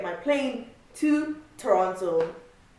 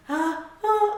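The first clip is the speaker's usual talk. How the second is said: excited squeal; 10 semitones higher than usual. Retold or imitated. Relief excitement.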